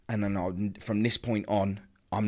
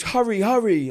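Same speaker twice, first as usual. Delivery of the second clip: high, loud, frantic plea; retold frantic urging; 11 semitones higher, 7 dB louder than usual. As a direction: imitate a panicked mother urging haste. Mother's urgent plea retold.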